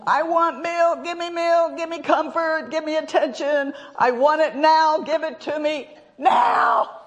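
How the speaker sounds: howling infant tantrum; screaming baby. Retold or imitated. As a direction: wail like a newborn wanting milk now. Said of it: "a baby comes out of the womb... Screams".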